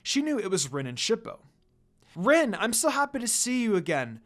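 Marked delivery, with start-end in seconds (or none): 2.2-4.2 high, louder happy greeting; overjoyed to see Rin again; excited exclaim. A narrator's reading. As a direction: burst out with joy at seeing her. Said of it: "Kagome exclaimed excitedly".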